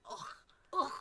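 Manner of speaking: throaty "ugh" as if something's stuck; mock Scottish throat noise. Co-host caricaturing Scottish sounds, throaty grunt. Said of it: "that's what they sound like".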